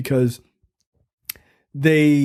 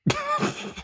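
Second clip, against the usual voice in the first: high squeaky laugh; 8 dB quieter than usual. Real, not acted. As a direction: giggle way up high. Laugh after shocked what.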